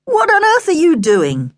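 high-pitched, baffled demand; astonished, exasperated question; incredulous dad. A narrator's reading. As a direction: ask it in loud disbelief. Dad startles son mid-pretence.

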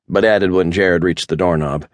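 ordinary delivery

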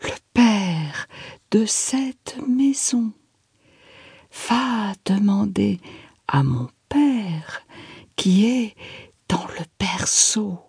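slow, distant whisper; soft echoing dream voice. A narrator's reading. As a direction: whisper as if from far away. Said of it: "une voix lointaine".

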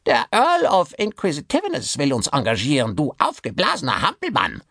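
high, nasal-free rasping puppet voice, insolent. Acted. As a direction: sneer it like a cheeky dummy. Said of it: "mit ihrer hohen, schnarrenden Stimme". (hohe, schnarrende Puppenstimme)

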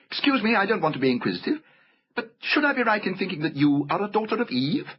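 high, courteous fawn voice; inquisitive polite fawn. A narrator's reading. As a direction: ask delicately as a fussy fawn.

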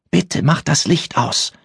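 put-on high, slow, begging child; frightened child pleading; pleading girl. A narrator's reading. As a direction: beg her to turn off the light. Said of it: "flehte die Zimmernachbarin".

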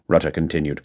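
usual speaking voice

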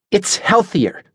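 high-pitched, self-important retort. A narrator's reading. Natasha defends her diet to Adelaide, self-importantly. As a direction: say it full of your own importance. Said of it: "said self-importantly".

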